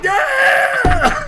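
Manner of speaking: very high mock cheer of a cinema crowd; imitated crowd cheer. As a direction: whoop like the whole audience. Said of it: "everybody in the theater's like".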